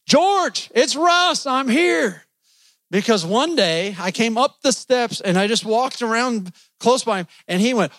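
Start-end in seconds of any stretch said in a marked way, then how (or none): 0.1-2.2 retold yelling. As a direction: shout his name up high so George hears. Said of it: "I would start yelling".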